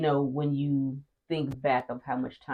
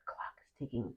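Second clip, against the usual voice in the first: hushed mock-desperate whisper; barely voiced, pleading aside; whispered plea; 4 semitones lower, 15 dB quieter than usual. Acting out begging her husband.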